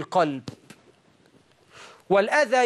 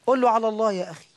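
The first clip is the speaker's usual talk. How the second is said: usual speaking voice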